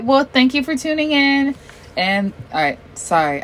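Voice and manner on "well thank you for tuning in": much higher presenter voice; put-on sign-off voice. Real, not acted. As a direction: pitch the thanks way up like a show host. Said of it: "I don't want that voice".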